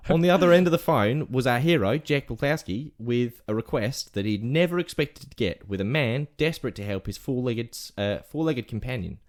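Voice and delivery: story read in put-on Aussie; Australian accent. Telling the lost-dog story on request, Australian accent. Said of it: "whole rest of the segment in Australian accent".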